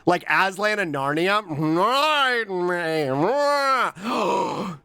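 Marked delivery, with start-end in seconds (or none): 1.5-4.8 drawn-out slimy slug voice; very slow slimy snail voice. Pretend. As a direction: ooze it out slowly. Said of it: "talks in het voice".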